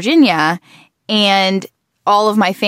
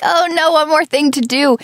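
mock dismay; 8 semitones higher than usual. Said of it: "I'm like".

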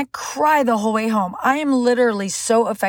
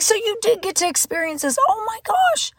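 high-pitched astonished realisation; retold wide-eyed amazement; amazed; 11 semitones higher than usual. Amazed reply to husband, retold.

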